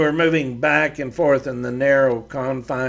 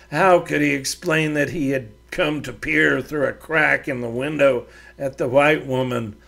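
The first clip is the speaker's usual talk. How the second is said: plain reading voice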